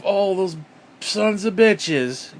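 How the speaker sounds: slow, drawn-out mock outrage. Mocking conservatives, drags out a sarcastic curse. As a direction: stretch it out sarcastically.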